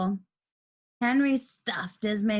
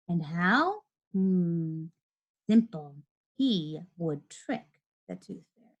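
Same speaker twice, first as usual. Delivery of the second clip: scheming; 6 semitones lower than usual. A narrator's reading. Henry's sly plan, narrated.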